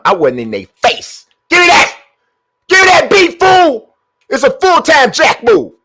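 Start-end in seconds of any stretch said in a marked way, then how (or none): 1.5-5.7 high, loud barking at the screen; hype shouting. Real, not acted. Streamer shouting at the game for the ball. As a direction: shout like you're snatching it.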